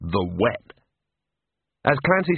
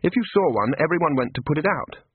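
ordinary delivery, nothing marked

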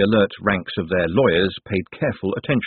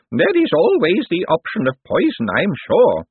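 put-on high little old-lady voice; elderly voice; 9 semitones higher, 4 dB louder than usual. A narrator's reading. Elderly aunt asks after the dog, high old voice. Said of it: "said an elderly voice".

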